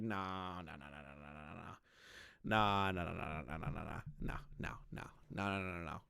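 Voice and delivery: low, quiet repeated no's under the breath; muttering no. Real, not acted.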